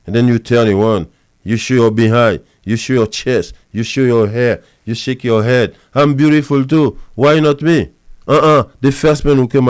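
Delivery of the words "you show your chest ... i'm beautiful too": ordinary talk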